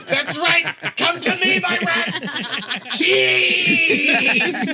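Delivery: high, laughing hammed-up madman calling his rats; mad rat whisperer. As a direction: croon to your rats like a cartoon lunatic. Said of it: "just like".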